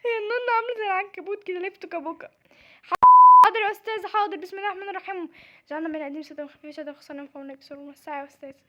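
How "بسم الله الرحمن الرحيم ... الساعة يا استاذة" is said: fast, low, soft gabble; muttered recitation. Acted. Nervous doctor recites before starting, low rapid mutter. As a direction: rattle it off under the breath.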